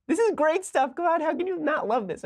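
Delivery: gleeful high excitement. Real, not acted. Host thrilled by the tweet, squealing with glee.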